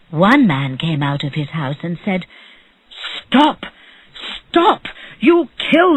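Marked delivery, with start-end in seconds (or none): 3.2-6.0 high cries easing into a warning; urgent call. A narrator's reading. Neighbour tries to stop the rich man, calling out urgently. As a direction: call out to halt a beating.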